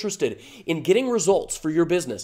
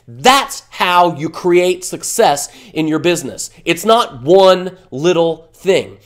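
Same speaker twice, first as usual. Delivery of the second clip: loud, slow, heated push; really fired up, hammering the point home; 7 dB louder, slower than usual. Real, not acted. Fired up while lecturing his viewers, loud and slow. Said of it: "I get a little fired up".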